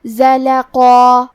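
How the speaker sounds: زلقا with a heavy ق and a full, dark final alif; heavy recited demo. Shown as a demonstration. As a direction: draw out زلقا slowly, ق and the alif both heavy. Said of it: "بھاری آواز میں پڑھیں گے".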